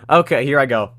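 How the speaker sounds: ordinary talk, nothing marked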